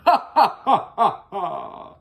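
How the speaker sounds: villainous ha ha ha; evil cackle for the story; scary laugh. Acted. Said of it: "That's my scary voice".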